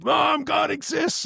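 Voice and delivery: high, drawn-out excited shout. Retold or imitated. Motorcyclist son to his mother, excited high shout.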